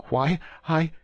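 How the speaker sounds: high, loud frightened cry; high-pitched eager cry from a terrified prisoner. A narrator's reading. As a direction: Bonacieux cries out in high panic. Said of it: "cried Bonacieux".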